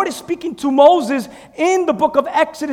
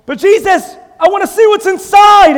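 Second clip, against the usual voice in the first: higher, louder pleading cry; pleading Philip; 5 semitones higher, 6 dB louder than usual. Acted. Philip begging Jesus to show what's inside, loud plea. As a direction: beg to see inside.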